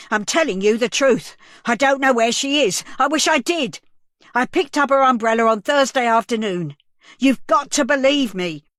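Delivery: high, loud, panicked insistence; desperate plea. A narrator's reading. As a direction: beg them to believe you.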